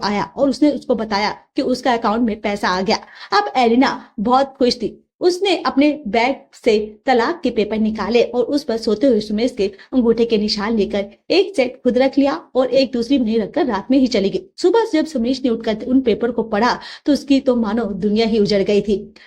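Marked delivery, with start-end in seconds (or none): none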